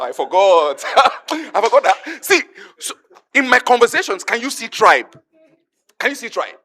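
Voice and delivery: loud, laughing excitement to the crowd; excited. Real, not acted. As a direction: burst out laughing and rally the room. Excited aside to the congregation.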